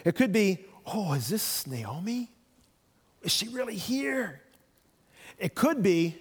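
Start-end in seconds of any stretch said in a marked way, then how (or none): 0.9-4.4 put-on warm, glad surprise at an old friend; delighted greeting. Shown as a demonstration. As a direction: greet her like a welcome returning friend. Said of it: "a lot can be in tone".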